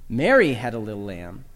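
"Mary" punched loud with a swooping pitch; stress on MARY. Shown as a demonstration. Teacher showing it wasn't Bobby, stress on "Mary". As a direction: hit "Mary", let the rest drop low. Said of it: "Emphasize the first word".

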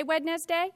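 "Wednesday" as wed-nes-day, rising into a question; spelling pronunciation. Shown as a demonstration. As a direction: query "Wednesday" with the d pronounced. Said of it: "think differently to spell a word than how we actually pronounce it".

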